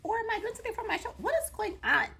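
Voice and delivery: hammed-up puzzlement at herself; confused. Retelling her gym confusion to her husband, mock-baffled. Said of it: "so now I'm very confused".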